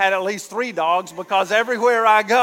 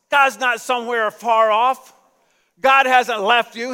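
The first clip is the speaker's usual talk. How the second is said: normal talking voice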